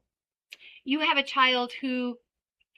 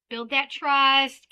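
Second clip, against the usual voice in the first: slow emphasis; 4 dB louder, much slower than usual. Shown as a demonstration. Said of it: "slow emphasis".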